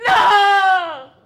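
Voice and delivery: high, loud laughing scream; panicked scream. Retold or imitated. Kids shrieking as Dad turns the car, screaming.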